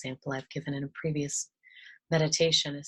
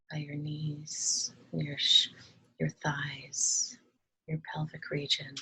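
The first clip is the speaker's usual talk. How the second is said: calm, soft meditation voice with long pauses; unhurried, hushed instructions, leaving space to breathe; guided meditation; 5 dB quieter than usual. Acted. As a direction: gently guide listeners through relaxing each body part.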